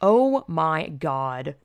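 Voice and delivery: slow, loud exasperation; appalled. Real, not acted. Host reacts to babies in face shields, appalled. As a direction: drag out each word, loudly appalled.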